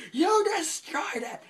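very high, loud, drawn-out accusation; hammed-up cartoon outrage, slow and piercingly high; funny exaggerated yell. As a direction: stretch each word in a cartoonish shriek. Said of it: "says it in a really funny way".

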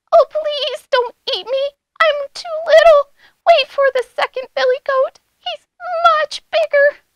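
high, loud little-goat pleading; very small goat voice. A narrator's reading. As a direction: plead in a tiny high squeak. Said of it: "in his very small voice".

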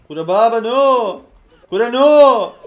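loud, high drawn-out cry of no; exasperated high shout; dismayed shout. Real, not acted. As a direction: yell out in dismay. Game goes wrong, cries out.

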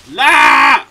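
loud, low bellowed "no"; frustrated shout. Real, not acted. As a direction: shout it as you fall again. Gamer falls again, shouts.

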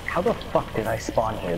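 ordinary talk

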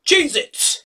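loud, high, laughing host intro; excited announcement. Real, not acted. Host calling Johanna to the next snack, loud and bright. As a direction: kick off the next round with a bang.

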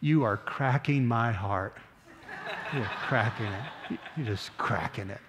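put-on soft, slow little child's plea; lightly voiced toddler, soft and slow with a laugh. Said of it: "and she says".

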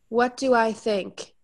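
usual speaking voice

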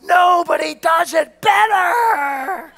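high, drawn-out chanting yell; imitated crowd shout. Whole banquet crowd hailing the top salesman, long mock shout. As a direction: stretch the last word like a roaring crowd. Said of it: "the whole crowd shouts out".

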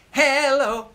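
Hello sung high and loud; loud call. Shown as a demonstration. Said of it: "I'll sing 'em, you sing 'em back".

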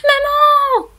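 high loud scared call; boy yelling, growing fear. A narrator's reading. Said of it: "crie le petit garçon de plus en plus effrayé".

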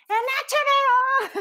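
high, loud, drawn out blurted answer; shouting the answer at the screen with glee; excited shout. Retold or imitated. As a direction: yell the answer out eagerly, slowly. Her own answer, shouted.

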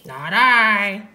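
drawn-out dog character voice; storybook dog. A narrator's reading. Dog refuses to help harvest, drawn-out character voice. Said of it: "said the dog".

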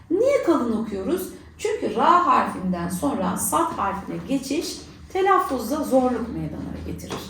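ordinary talk, nothing marked

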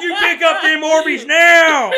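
hammed-up Southern dad shout, high and drawn out; yelling Southern man. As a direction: bellow it slowly as a furious Southern father. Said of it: "you turned into a Southern man".

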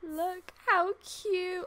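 usual speaking voice

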